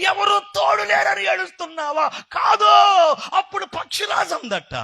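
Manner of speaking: high, loud shouted questions and answers; preached parable dialogue. Acted. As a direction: act out the exchange at full preaching pitch. (గొంతెత్తి సంభాషణను నటిస్తూ ప్రసంగం)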